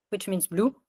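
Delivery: usual speaking voice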